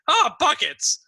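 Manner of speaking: put-on high, loud old man's cry; startled old man's high yelp; old man yelling out. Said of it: "yell out".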